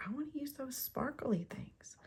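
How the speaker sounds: put-on whispered, high childlike wish; eager husband. Husband asking to use the gems, high and whispered. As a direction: whisper it like a kid wanting sparkles. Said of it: "he was like".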